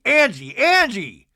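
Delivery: mock exasperated call to shut someone up; insistent calling out. Acting out Mary cutting Angie off.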